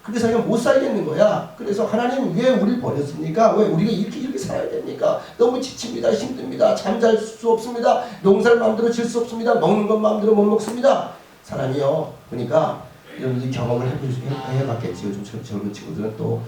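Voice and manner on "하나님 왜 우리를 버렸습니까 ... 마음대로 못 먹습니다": high-pitched plea of complaint to God; lamenting as a worn-out people; acted desperate complaint. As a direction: pile up the hardships, voice raised high. Acted lament of oppressed Israelites to God.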